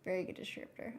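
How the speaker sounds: soft unvoiced whisper to herself. Real, not acted. Echoing the compliment to herself in a whisper. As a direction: breathe it out as a private echo.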